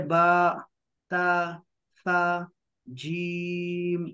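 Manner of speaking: letters drawn out one by one; slow, drawn-out Arabic letter recital; alphabet recitation. Shown as a demonstration. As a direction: chant each Arabic letter slowly, like a Quran teacher.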